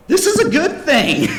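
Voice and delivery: high, loud, slow mock insistence; exasperated insistence. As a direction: spell it out loudly to someone missing the point. Acted exasperated reassurance to customer.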